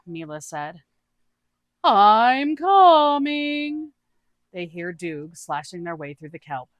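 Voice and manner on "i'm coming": high, slow, drawn-out quirky voice; odd character voice. Acted. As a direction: stretch each word out in a high, cheerful oddball voice. Doog's voice again.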